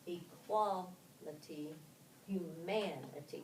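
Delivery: equality and humanity drawn out slowly, second syllables stressed, e-QUAL-i-ty and hu-MAN-i-ty; teacher slowly modelling the shifted stress; slow stress demo. Shown as a demonstration. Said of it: "shifts from the s-, first syllable to the second syllable".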